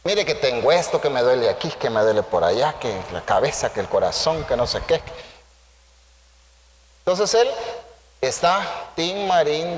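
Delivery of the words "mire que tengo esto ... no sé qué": lower, fading list of complaints; complaining patient. Retold or imitated. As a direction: list aches like a whiny patient, trailing off low. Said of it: "qué tenés que empezar a decirle tú".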